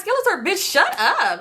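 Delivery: high-pitched fed-up retort; mock-tearful snap at a friend; exasperated snapping. Crying driver to her dismissive friend, exasperated.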